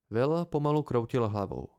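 ordinary delivery, nothing marked

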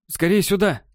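громкий высокий окрик; позови через зал. Acted. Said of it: "крикнул он Марку". (окрик)

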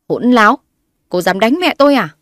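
husband yells after slapping his wife; angry yell. A narrator's reading. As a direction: yell as you slap her.

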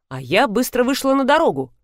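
plain reading voice

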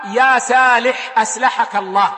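سالح and أسلحك with a plain thin s in place of ص; drawn-out يا سالح، أسلحك, both with light sin; sad swapped for sin. Retold or imitated. Scholar teasing a grammarian named Salih, سالح and أسلحك said with sin. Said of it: "أن تقول السين والصاد".

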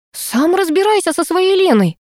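high, sharp angry retort. A narrator's reading. Girl pushing drunk Gena away, angry and high. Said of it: "злилась я".

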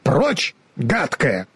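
halting, slow stammered shoo; a scarecrow struggling to get words out; mild mock shout; stumbling shoo. A narrator's reading. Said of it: "закричал я".

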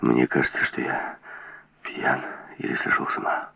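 low voice sinking into whisper; halting, stunned murmur; faltering. A narrator's reading. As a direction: falter as if doubting your own senses. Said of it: "запинаясь".

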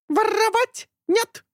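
high slow squawking bird. A narrator's reading. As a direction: squawk a denial like a parrot. Talking bird denies. (птичий крик)

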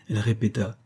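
ordinary delivery, nothing marked

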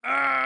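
high piercing shriek; shrill scream. A narrator's reading. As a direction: shriek in panic. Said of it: "заверещал Инквизитор".

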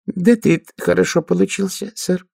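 high, nervous squeak. Acted. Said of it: "нервно пискнула сержант Пападополу".

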